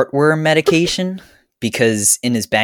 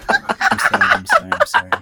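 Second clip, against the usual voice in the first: breathless laughing apology; sorry through giggles; after laughing hard. Acted. Caught deviating, laughing.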